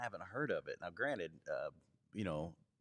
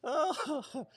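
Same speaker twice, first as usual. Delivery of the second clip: laughing; 8 semitones higher than usual. Real, not acted. Laughing at helium filter.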